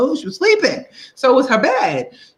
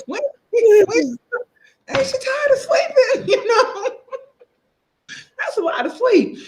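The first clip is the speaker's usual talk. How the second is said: put-on much higher whiny child voice, laughing through it; impatient little kid. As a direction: whine like a kid tired of waiting. Child complaining about long sleep.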